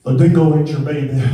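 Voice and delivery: put-on [name] croon with an Australian accent, loud and quick; sing-talk [name] in a broad Australian accent. Comic doing Australian [name] for the crowd, loud Australian [name] croon. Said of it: "impression of Australian [name]".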